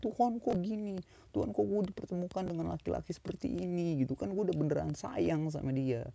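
high, pleading mock-tearful complaint to God; lamenting to God in a high, half-crying voice; imitating a tearful prayer. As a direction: whine to God like a hurt lover on the verge of tears. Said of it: "lo cuma bisa nangis".

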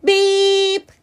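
high, loud, held truck-horn beep; bright held toot. A narrator's reading. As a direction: imitate a little horn on one steady note. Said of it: "said Blue".

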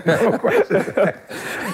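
drawn out slowly through laughter; slow laughing repeat. Shown as a demonstration. Repeating his line to the hosts, slow and laughing. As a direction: savor each word while laughing. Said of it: "I, no question asked".

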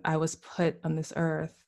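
ordinary talk

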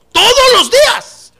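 high, loud, drawn-out shout; insistent pastor's shout. Acted. Pastor ordering a reluctant helper, loud insistent shout. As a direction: hammer it at the unwilling helper.

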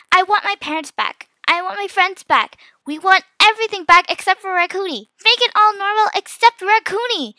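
high, insistent wishing voice; earnest wish. A narrator's reading. Magic wish written to restore world.

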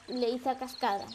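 ordinary delivery, nothing marked

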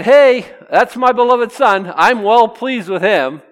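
high, loud call from heaven; proud loud announcement. Acted. God announcing his Son from heaven, loud proclamation. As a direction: proclaim it out loud for all to hear.

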